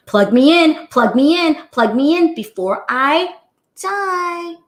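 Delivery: high, loud, desperate little voice of a dying phone; hammed-up cry of a battery on red; pleading phone battery. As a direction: beg to be charged like a phone about to die.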